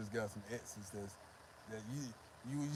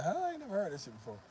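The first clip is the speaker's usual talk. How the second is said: put-on higher, fast, puzzled girl; fast, higher mock girl voice; 6 semitones higher, faster than usual. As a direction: play the confused girl hearing odd Spanish. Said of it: "they gonna be like".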